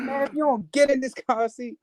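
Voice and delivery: frustrated friend voice. Retold or imitated.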